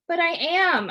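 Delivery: high, loud protest. Acted. Son protesting to his dad, high and loud. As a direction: insist he's doing as told.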